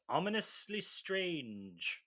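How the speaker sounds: slow, drawn-out quote; Barnaby. Retold or imitated. Retelling Barnaby's reaction to the eclipse, slow and portentous. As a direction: stretch both words out slowly, portentously. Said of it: "And Barnaby goes".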